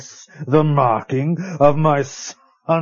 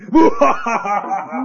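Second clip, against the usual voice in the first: villain laugh; 7 semitones higher, 3 dB louder than usual. Acted. Villain gloats about the mines.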